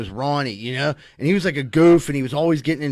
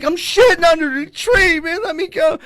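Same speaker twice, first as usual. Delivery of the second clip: high, louder pleading; mock desperate yell; 12 semitones higher, 4 dB louder than usual. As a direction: beg to be left alone. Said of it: "I was like".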